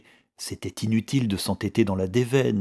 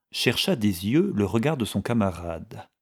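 ordinary delivery, nothing marked

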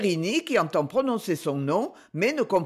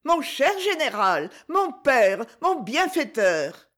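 put-on high, effusive child's outburst; high gushing boy, overjoyed; gushing cry; 6 semitones higher, slower than usual. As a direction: throw yourself at him, gushing. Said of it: "et s'écrie".

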